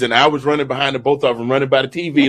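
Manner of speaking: normal talking voice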